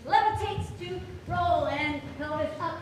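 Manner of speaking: high, loud dance calls; loud excited cueing. Real, not acted. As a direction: call the moves out with energy. Teacher calling dance cues.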